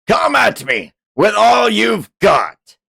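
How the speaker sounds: high, slow battle shout; Raditz voice. Acted. Streamer voicing the villain's challenge in a Raditz shout. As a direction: bellow the challenge like a Saiyan villain. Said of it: "get the fucking Raditz voice".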